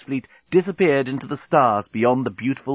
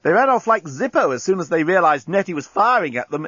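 high, eager corporal; enthusiastic; 7 semitones higher, 3 dB louder than usual. A narrator's reading. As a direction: exclaim it eagerly, pitch up. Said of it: "exclaimed Inchby Wiglet enthusiastically".

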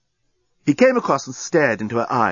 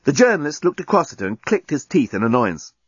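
plain reading voice

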